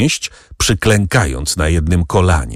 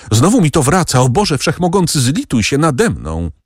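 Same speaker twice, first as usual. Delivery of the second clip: higher-pitched, mildly acted plea; anguished cry; 7 semitones higher than usual. A narrator's reading. Stricken Barrois begs God for mercy, anguished, higher-pitched plea. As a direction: plead as an attack returns, read with restraint. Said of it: "zawołał Barrois".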